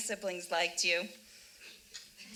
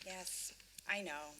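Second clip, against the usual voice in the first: quiet; 3 semitones lower, 14 dB quieter, slower than usual. Acted. Tense quiet confession moment.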